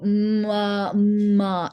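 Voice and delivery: "ma, ma" drawn out slowly from a long m into a; "ma, ma" with a held hum before each vowel; effortful transition. Shown as a demonstration. Showing the move from M to A, laboured transition. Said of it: "difficulty moving from one position".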